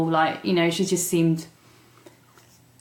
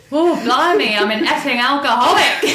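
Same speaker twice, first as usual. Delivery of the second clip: loud, high retold exclamation breaking into laughter; comic outburst; 7 semitones higher, 7 dB louder than usual. Newcomer answers the meeting's leader, mock-alarmed, laughing outburst. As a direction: blurt it out higher and louder, laughing. Retells own stunned reaction, laughing.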